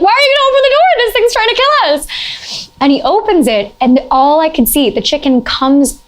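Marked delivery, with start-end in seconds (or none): 0.0-2.7 high, panicky retold yelling; terrified high-pitched plea not to open; panicked shriek. Friends panic as door opens.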